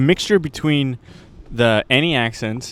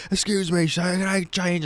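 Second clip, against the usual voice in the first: homeless beggar; 5 semitones higher than usual. Retold or imitated. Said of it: "Homeless guy comes in, he's like".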